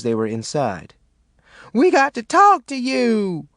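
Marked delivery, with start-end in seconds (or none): 1.7-3.5 high, louder shriek; urgent shriek to be heard. A narrator's reading. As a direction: burst in shrieking. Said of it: "Caddy shrieked".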